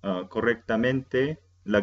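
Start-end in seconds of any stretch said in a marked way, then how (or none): none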